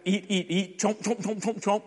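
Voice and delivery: high, quick munching sounds of a hungry grub; munching caterpillar. Acted. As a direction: chomp it out like a greedy little caterpillar. Caterpillar eating, acted out.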